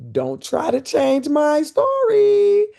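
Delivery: slow, high mock-firm warning; defiant. Real, not acted. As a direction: draw it out, daring them. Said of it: "defiant aside".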